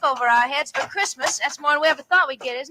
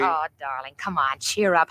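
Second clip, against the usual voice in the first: tender wife soothing a gloomy husband; coaxing; 9 semitones lower than usual. Acted. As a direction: gently cheer him up.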